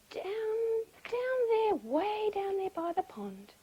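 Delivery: high, stretched-out storytelling; slow, lifted, faraway narration; drawn-out distance. A narrator's reading. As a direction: draw out each "down" to show how far. Stretching words to show distance.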